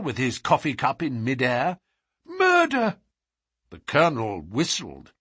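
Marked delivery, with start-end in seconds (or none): 2.3-3.0 very high, agitated cry; shocked exclamation. A narrator's reading. Butler correcting the Colonel's guess, crying out. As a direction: cry it out in horror. Butler breaks news of a killing.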